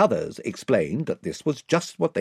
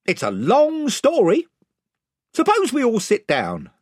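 raised voice of a gang chief; 13 semitones higher, 6 dB louder than usual. A narrator's reading. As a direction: warn them off as spokesman, pitched up. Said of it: "said the chief voice".

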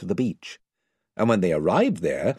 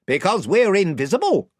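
raised voice of a gang chief; 12 semitones higher, 5 dB louder than usual. A narrator's reading. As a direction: warn them off as spokesman, pitched up. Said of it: "said the chief voice".

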